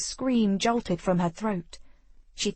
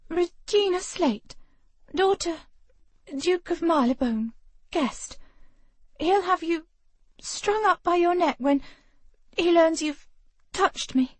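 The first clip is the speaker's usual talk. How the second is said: put-on high, breathless young lady; pinned and gasping, but defiant; young woman voice; 9 semitones higher than usual. Female character voiced by narrator.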